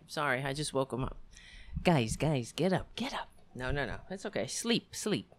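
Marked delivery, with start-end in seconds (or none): 1.8-5.3 gentle hush for pets; soothing soft voice. Real, not acted. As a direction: quietly settle the cats down. Soothing the woken cats.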